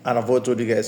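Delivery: ordinary talk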